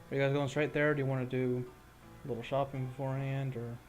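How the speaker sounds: ordinary delivery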